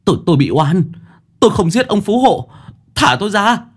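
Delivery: gào cao và to, nghẹn uất; kêu oan bằng hết sức. Acted. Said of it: "Dần uất ức". (Dần bị bắt oan, uất ức gào lên)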